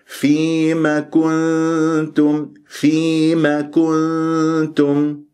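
very slow, level-toned recitation of فيما كنتم; natural madd. Shown as a demonstration. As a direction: draw out فيما evenly and hold the nasal n in كنتم. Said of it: "جبت المد الطبيعي".

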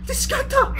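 very high, strangled disbelief; shocked squeal. Real, not acted. Player shocked by what the game shows, high squeal.

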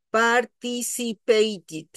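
slow "participated", syllable by syllable; "participated" pronounced with I. Shown as a demonstration. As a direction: stretch "participated", ending in "tid". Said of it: "ya lo pronuncio con I".

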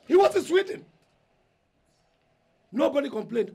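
high, loud yell of grievance; furious shout. Acted. Eldest son yelling about his absent brother. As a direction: blast it at them, fed up. Elder brother rants at family.

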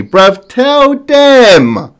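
very slow, high and loud drawn-out call; hype-man holler urging the rapper; stretched hype cry. Real, not acted. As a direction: drag it out high, cheering him on.

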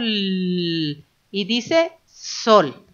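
sol drawn out very slowly, sound by sound. Shown as a demonstration. Teacher showing how the sounds join, very slow. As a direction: stretch every sound of sol. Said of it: "Vamos a unir los sonidos".

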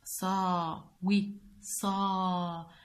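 وي، صا drawn out slowly; teacher modelling syllables slowly. Shown as a demonstration. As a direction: stretch each syllable. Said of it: "بوسط الكلمة هو صا".

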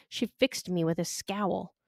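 usual speaking voice

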